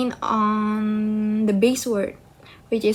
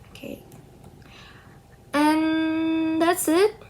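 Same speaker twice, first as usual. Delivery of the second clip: normal talking voice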